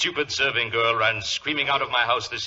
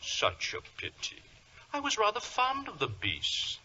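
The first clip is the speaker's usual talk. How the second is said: mock regret; 11 semitones higher, 5 dB quieter than usual. Villain mock regret after cat killed.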